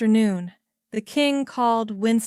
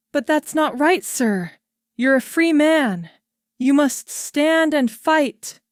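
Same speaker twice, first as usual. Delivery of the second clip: urgent plea; 6 semitones higher, 5 dB louder than usual. A narrator's reading. Churchill urging the king.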